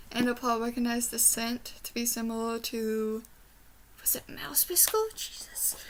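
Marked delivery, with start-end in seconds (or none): 4.0-5.8 whisper. Real, not acted. Trying to remember a name.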